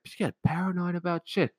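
usual speaking voice

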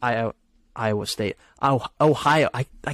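tripping over the state names; stuttering. Real, not acted. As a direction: stumble and restart on the name. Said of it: "I'm stuttering right now".

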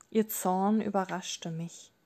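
ordinary delivery

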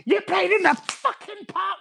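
very high, drawn-out mum shriek; hammed-up angry mum; furious mum. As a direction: screech it like an outraged mother. Acted furious mum in story.

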